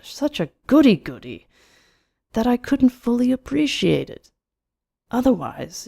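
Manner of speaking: animated club gossip with swooping pitch; chatty, mocking Carnes. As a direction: play a scornful, amused acquaintance. Voices Carnes in story.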